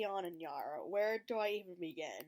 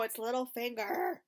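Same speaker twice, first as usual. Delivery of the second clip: ordinary delivery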